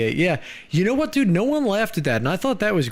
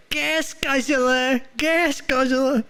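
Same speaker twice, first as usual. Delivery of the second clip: slow, high sing-song taunt; high mocking call; 10 semitones higher, much slower than usual. Mock yelling at Hummer driver.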